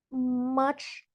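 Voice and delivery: words squeezed out on held breath; holding breath. Real, not acted. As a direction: speak while holding your breath. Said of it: "sorry, I'm holding my breath".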